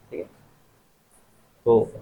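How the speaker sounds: ordinary delivery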